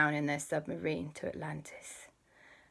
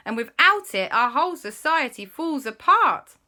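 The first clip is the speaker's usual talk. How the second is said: loud, raised anxious telling; worried guard; 6 semitones higher, 7 dB louder than usual. Acted. Mermaid guard explaining the lost ring, loud and worried. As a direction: report the crisis urgently. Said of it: "he said".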